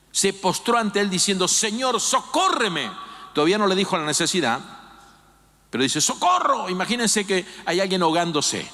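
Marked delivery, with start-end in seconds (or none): 1.5-2.9 pleading cry. Acted. Woman begs Jesus in the story. 6.0-6.7 cry for help. Acted. Woman's plea, repeated by the preacher.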